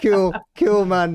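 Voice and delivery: high, loud sarcastic cool cool; sarcastic reaction to watching cars; mock sarcastic cool.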